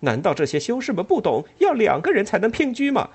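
high, loud scornful challenge; mock the monks for everyone to hear; loud indignant. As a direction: Ellen loudly mocking the monks in the hall. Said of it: "他大声地说".

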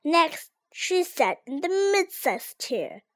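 usual speaking voice

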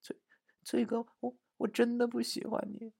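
high, soft, halting voice; small high stammer; wronged murmuring. Acted. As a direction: mumble it timidly, as if wronged. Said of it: "喃喃地说".

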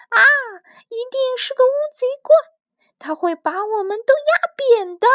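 high, loud, fast frightened shriek; little pufferfish shrieking in fright; terrified scream. Acted. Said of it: "小河豚吓得尖叫起来".

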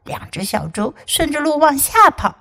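ordinary delivery

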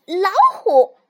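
high, very loud fox cub shout. A narrator's reading. Little fox names the next animal to mother, shouting loudly. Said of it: "小狐狸大声喊".

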